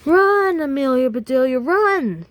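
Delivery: high, loud cheering yell; excited team yell. Acted. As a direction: urge her on at the top of your voice. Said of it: "yelled the boys".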